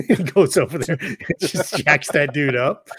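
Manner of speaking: normal talking voice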